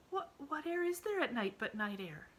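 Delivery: put-on high, bewildered child's question; puzzled girl. A narrator's reading. Emily questions her aunt's logic, high and puzzled. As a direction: stumble then ask in honest confusion. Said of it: "asked Emily".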